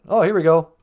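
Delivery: ordinary talk, nothing marked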